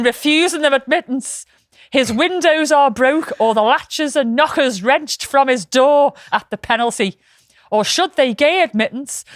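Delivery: hammed-up period reading; formal old letter read. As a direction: read it out like a pompous town crier. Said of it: "I was gonna try and do this in, a, in an American voice, but I'm not".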